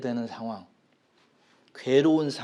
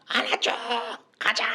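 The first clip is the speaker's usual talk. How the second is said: put-on child shouting; 8 semitones higher than usual. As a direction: call out like a child wanting arms. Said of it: "막 소리". (아이처럼 길게 떼쓰며 외치기)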